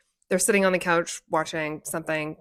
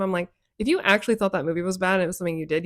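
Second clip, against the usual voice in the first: ordinary talk, nothing marked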